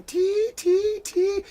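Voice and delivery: very high sing-song call of "Tea"; old tea seller's call. Retold or imitated. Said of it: "glass of tea going".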